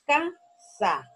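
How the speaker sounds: "Casa" split into two slow beats, ca... sa; syllable by syllable. Shown as a demonstration. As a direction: sound out "Casa" as CA, pause, SA. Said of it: "Podemos ver dónde está la mayor fuerza".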